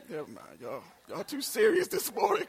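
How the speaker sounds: ordinary talk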